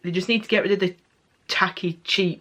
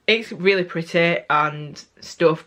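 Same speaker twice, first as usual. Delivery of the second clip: usual speaking voice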